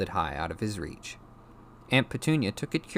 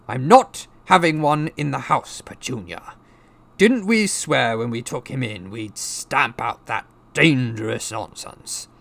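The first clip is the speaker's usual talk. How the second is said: loud, raised, ranting uncle; indignant Uncle Vernon; 6 semitones higher, 8 dB louder than usual. A narrator's reading. Uncle refusing one in the house, indignant rant. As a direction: lay down the law to your wife.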